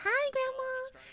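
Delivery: very high, cutesy greeting; acted high-pitched sweetness; sweet grandchild voice. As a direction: pipe up like a doting little grandchild. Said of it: "That's not how I talk to her, but, like, I'm like".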